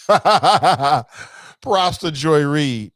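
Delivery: laughing through it, slowly. Real, not acted. Host cracking up while crediting Joy Reid, laughing. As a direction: let the laugh carry the words.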